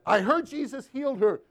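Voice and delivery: a touch of the boss yelling; mild retold yell. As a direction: confront him, half-yelling. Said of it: "she starts yelling a little bit".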